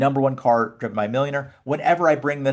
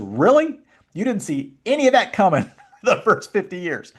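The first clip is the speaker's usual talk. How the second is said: high, loud mock-incredulous "Really?" with a laugh; laughing, wide-eyed sarcasm; sarcastic disbelief; 8 semitones higher, 5 dB louder than usual. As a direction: scoff at how blind they were. Psychologist mocking the shocked family.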